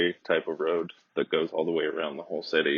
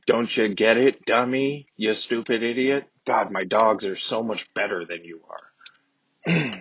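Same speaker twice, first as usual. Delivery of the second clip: louder mock condescension, slowing at the end; mock the woman looking down on him, condescending; condescending sneer; 4 semitones higher, 4 dB louder, slower than usual. Voicing condescending woman's thoughts.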